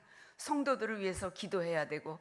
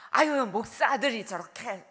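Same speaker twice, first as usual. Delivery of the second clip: higher, louder mock tut-tutting; mock disapproving gossip; 3 semitones higher, 10 dB louder than usual. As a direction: exclaim loudly, imitating scandalized churchgoers. 교인들의 못마땅한 험담을 흉내. (교인들이 못마땅해하는 말투를 흉내 내듯)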